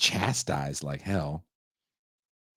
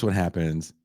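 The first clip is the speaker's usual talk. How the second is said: normal talking voice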